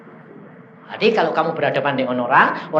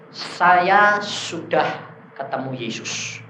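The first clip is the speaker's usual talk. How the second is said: very slow, drawn-out declaration; acted-out ponderous announcement; solemn claim; much slower than usual. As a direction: draw out each word slowly. Voices the shaman's solemn claim.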